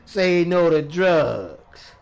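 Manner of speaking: loud, very slow mock slogan; sarcastic. Drug user preaching against drugs on stream, sarcastic. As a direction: belt out a drawn-out public-service slogan, mockingly. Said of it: "I'm being sarcastic for real".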